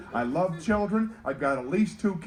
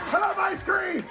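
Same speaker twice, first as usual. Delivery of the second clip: much higher, hammed-up child's cry; excited kid; 13 semitones higher than usual. Elevator kid bursts out about ice cream, high mock-excited shout. Kid mayor's outburst in the bit.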